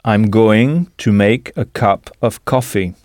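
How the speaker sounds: slow, careful "I'm going to make a cup of coffee"; slow repeat. Shown as a demonstration. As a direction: say each word slowly for the learners. Teacher repeats the model slowly.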